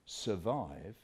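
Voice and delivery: low, soft, weighty word; hushed emphasis. Acted. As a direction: drop right down after a pause. Dramatic pause on key word.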